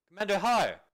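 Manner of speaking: loud, high call with a falling end; impatient shout to find him; shouting a name. Acted. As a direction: yell his name across the camp. Calling louder, no answer.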